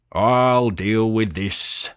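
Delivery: slow, loud, firm bull voice; voice that meant business. A narrator's reading. Young bull taking charge of the horse, firm and loud. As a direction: lay it down like he means business. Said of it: "said Pinky in a voice that meant business".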